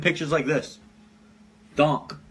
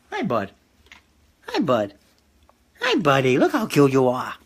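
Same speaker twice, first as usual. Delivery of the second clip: dog talk. Real, not acted. Greeting his dog.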